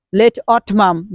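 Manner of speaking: "late autumn" as late AWT-mum; wrong pronunciation. Shown as a demonstration. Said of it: "you shouldn't pronounce".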